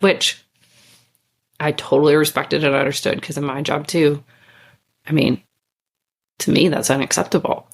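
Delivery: ordinary talk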